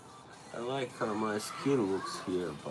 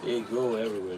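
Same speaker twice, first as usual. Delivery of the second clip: ordinary talk, nothing marked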